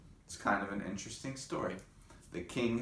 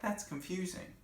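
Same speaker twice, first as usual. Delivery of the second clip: confused; 11 semitones higher, 3 dB quieter than usual. Retold or imitated. Teacher voicing own confusion.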